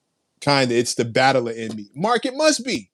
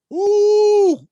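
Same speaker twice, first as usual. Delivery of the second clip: high, loud drawn-out ooh; long excited ooh, much higher and louder; hype yell; 8 dB louder than usual. Real, not acted. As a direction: let out a hype cry at the chat. Hyped by a donation.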